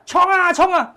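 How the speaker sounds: high, loud yelling of "冲啊"; 阿嬷在云霄飞车上兴奋大喊; shouted cheer. Retold or imitated. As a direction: yell it like a thrilled grandma on a rollercoaster. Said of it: "他喊".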